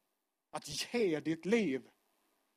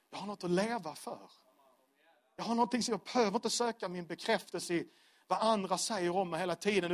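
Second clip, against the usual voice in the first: normal talking voice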